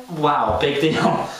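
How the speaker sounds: very slow, sarcastic mock awe. Teen retelling her own sarcastic reply to the guide, slow and unimpressed. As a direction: drag it out unimpressed. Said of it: "I'm like".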